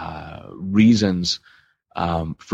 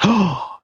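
sharp high imitated gasp of horror; hammed-up scandalised gasp; mock shocked gasp; 11 semitones higher than usual. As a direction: gasp like an offended Brit. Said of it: "British people just gasp".

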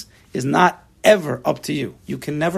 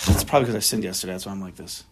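lower, fast, deflated mumble that fades softer; dejected; 9 semitones lower, 6 dB quieter, faster than usual. Retold or imitated. Person blaming himself for yesterday's sin, dejected mumble. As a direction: sigh it out like he's given up on himself. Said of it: "how does he feel right now".

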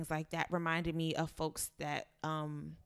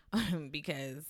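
ordinary delivery

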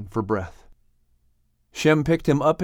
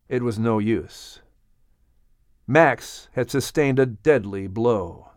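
ordinary delivery, nothing marked

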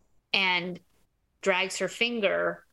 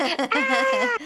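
high, loud imitation scream; long mock scream; 5 dB louder than usual. Imitating the screamed outtake, long high scream. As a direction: hold out a hammed-up girlish shriek. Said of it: "she screamed so long and so loud".

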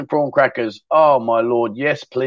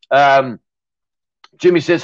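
normal talking voice

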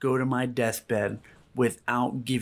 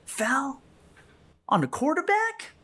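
very high-pitched comic disbelief; mock incredulous; 14 semitones higher than usual. Ref doubting a foul on the quarterback, mock incredulous. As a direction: shoot the question way up in mock astonishment. Acting ref's incredulous reply.

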